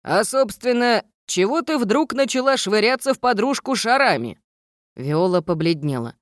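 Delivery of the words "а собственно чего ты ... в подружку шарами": gruff stern gnome teacher. Acted. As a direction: scold like a strict old gnome. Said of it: "строго спросил".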